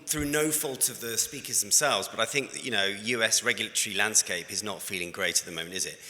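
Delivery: ordinary talk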